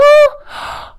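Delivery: high excited gasp; delighted gasp. Acted. Librarian sees the blackbirds come back, delighted gasp. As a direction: gasp with delight as they return. Birds return.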